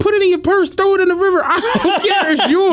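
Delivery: high, laughing brush-off; laughing dismissal. Retold or imitated. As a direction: wave her off while laughing. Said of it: "I'm like".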